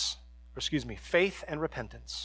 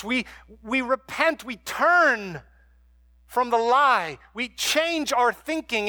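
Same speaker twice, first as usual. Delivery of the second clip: higher, louder sermon climb; fervent preaching; 6 semitones higher, 4 dB louder than usual. Acted. Pastor urging the congregation away from worry, fervent preaching. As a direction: preach it with rising fire to the congregation.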